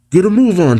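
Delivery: put-on high, hurried call to the boy. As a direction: hurry him along from afar. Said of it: "Ziggy calls out".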